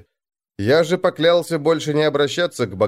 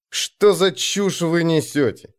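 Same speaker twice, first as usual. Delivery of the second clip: higher, slow brush-off; dismissive; 6 semitones higher, slower than usual. A narrator's reading. Jarl brushing off his men's nonsense, dismissive. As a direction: wave the idea away, drawing it out. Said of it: "отмахнулся Арнгрим".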